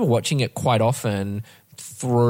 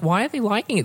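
baffled; 9 semitones higher than usual. Retold or imitated. Retold baffled reaction.

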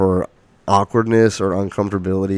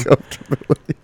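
laughing; 4 semitones higher, faster than usual. Real, not acted. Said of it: "Level of discomfort".

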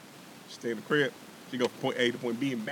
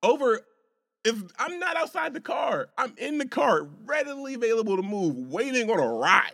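high, loud outraged shout; heated shout; 9 semitones higher, 4 dB louder than usual. Real, not acted. Scene: ranting to his co-host about the parking fight, shouting. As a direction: shout the injustice out.

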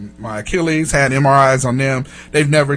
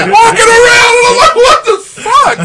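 much higher, louder and slow, cracking up; laughing disbelief; 6 dB louder, much slower than usual. Real, not acted. Telling the crew about the noisy waiting room, laughing hard. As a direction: howl it out through helpless laughter.

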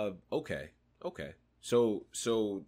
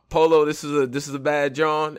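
a hint of a louder, higher hype man; Easy catchphrase; 5 semitones higher, 7 dB louder than usual. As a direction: lightly imitate a friend's catchphrase. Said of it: "as Easy would say".

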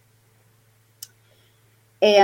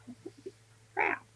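whispered, much softer retold shock; stunned whisper; 5 semitones higher, 14 dB quieter than usual. Investigator thrown off her game, retold stunned whisper. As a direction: whisper it under your breath, stunned.

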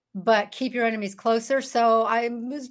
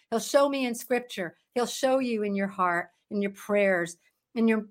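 usual speaking voice

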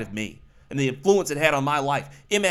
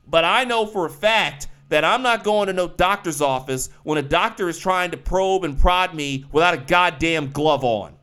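normal talking voice

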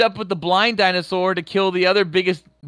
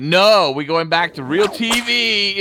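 higher, slow, indignant refusal; mock protest; 5 semitones higher, 3 dB louder, slower than usual. Driver to channel switcher, loud mock protest. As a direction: overrule them, drawing it out. Retold objection over changed channel.

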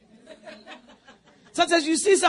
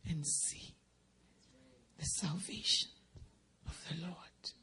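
whispered, much softer phrase; hushed scripture; 8 semitones lower, 17 dB quieter than usual. Real, not acted. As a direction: whisper the promise.

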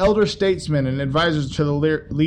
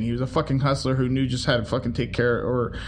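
normal talking voice

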